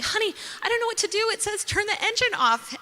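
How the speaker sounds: high, fast, helpless panic; high quick fretful plea; panicked to husband. Retold or imitated. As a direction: rush it up high to your husband.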